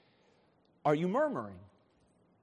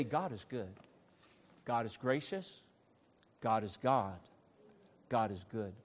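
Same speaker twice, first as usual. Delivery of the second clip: low, soft, measured refrain; quiet affirmation; 8 semitones lower, 8 dB quieter than usual. Shown as a demonstration. Preacher gives the congregation the words to say, quiet affirmation. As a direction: say each one quietly, with pauses between.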